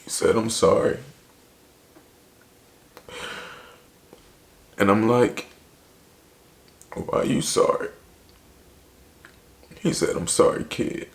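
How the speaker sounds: fighting back tears while telling it; tearful. Real, not acted. Voice strained after breaking down.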